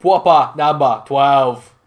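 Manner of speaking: loud, slow, punched-out advert slogan; exaggerated Irish promo voice; Conor McGregor. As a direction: boom it out word by word like a whiskey promo. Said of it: "talk like Conor McGregor".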